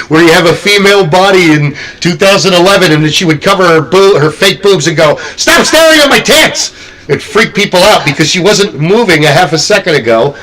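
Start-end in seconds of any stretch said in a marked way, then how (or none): 5.4-6.7 high-pitched mock shriek; indignant outburst. Imitating a fake corpse startling haunt guests, indignant high shriek. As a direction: squeal it in sudden outrage, hammed-up.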